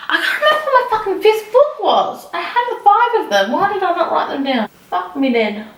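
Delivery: frustrated. Real, not acted. Frustrated at forgetting her fifth book.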